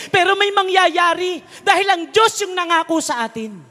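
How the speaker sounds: loud, high, fervent sermon voice; fiery preacher rallying the congregation; full-force preaching. Acted. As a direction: preach it out to the whole church.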